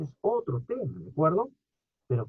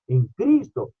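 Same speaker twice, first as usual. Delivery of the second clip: normal talking voice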